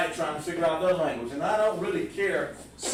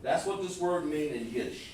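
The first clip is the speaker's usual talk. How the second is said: usual speaking voice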